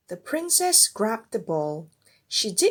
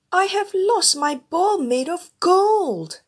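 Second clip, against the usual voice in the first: upset princess; 10 semitones higher, 7 dB louder than usual. A narrator's reading. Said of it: "said the princess".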